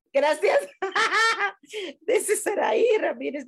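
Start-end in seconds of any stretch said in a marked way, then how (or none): 0.6-2.0 bright high burst of laughter. Real, not acted. Thanking a viewer for liking her antics, high laugh. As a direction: laugh out loud, pitched high.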